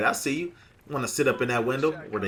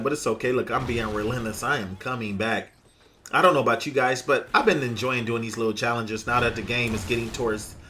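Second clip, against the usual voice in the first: usual speaking voice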